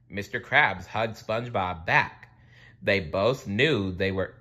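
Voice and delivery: ordinary delivery, nothing marked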